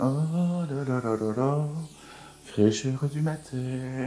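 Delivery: blissful sigh. Real, not acted. Relishing cold water on face.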